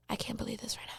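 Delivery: whispered, retold shock; hushed disbelief. Boyfriend's mother after hearing of cheating, hushed disbelief. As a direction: drop to a stunned whisper. Voicing his stunned mother.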